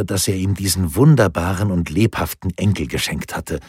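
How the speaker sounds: ordinary delivery, nothing marked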